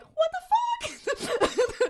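high, drawn-out mock disbelief breaking into laughter; mock shock. Two women see their friend is a demon, mock shocked. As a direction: squeal it in exaggerated shock.

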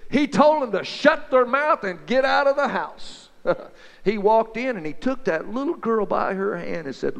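usual speaking voice

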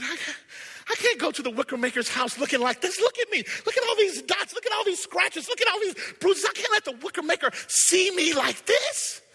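put-on high, pleading little boy voice; small boy pleading in despair; ashamed boy. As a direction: beg to be let off, ashamed of yourself.

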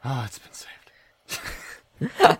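slow breathy whisper. Real, not acted. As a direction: whisper it under your breath. Whispered aside.

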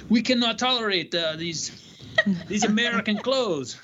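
badly mock a snooty French boss; bad French accent sneering at American clothes; terrible French accent. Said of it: "that's a terrible French accent".

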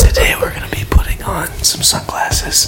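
hushed ASMR host voice; mock ASMR whisper. Podcaster teasing his friend with an ASMR whisper. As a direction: whisper close to the mic like an ASMR video.

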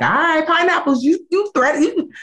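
high teasing threat; playful code-word warning; mock warning.

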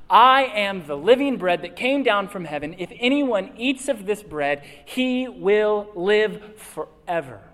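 proclaiming Jesus' words to the congregation at full volume; full-force preaching. Acted. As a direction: preach it out with conviction.

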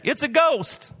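high frightened cry of the disciples; terrified cry. Acted.

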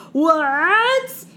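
loud, high, stretched-out disbelieving what; a hammed-up gasp of total disbelief; mock shock. To her co-host, feigning shock at the obvious twist, loud and high.

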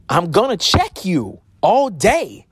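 defiant vow. Real, not acted. Vowing not to stay silent.

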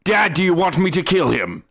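high, quick little creature voice; eager spirit son. Acted. Spirit son asks his dad for orders, high and quick. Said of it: "he goes".

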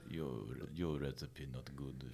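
low, slow mock-Russian accent, quiet and halting; soft Russian accent slipping toward Japanese chef; botched Russian accent. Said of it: "That's Japanese chef, not Russian".

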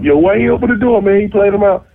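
high, retold agitated complaint; gassing up. Friends outside after the snub, gassing him up. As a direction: stir up your slighted friend.

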